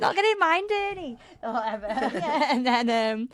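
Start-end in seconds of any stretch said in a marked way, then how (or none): 0.0-1.2 high mock Scouse grumble; Scouse accent. Mate on the phone protecting his own car, mock Scouse accent. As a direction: do the mate's Scouse voice, indignant. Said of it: "I wanna do a Scouse".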